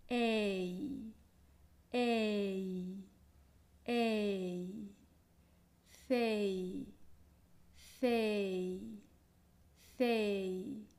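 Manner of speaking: ei glided smoothly from e to i, no break; gliding ei. Shown as a demonstration. Teacher models the unbroken ei glide for the student. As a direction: slide e into i without a pause. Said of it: "deslizar el sonido de la e hacia una i".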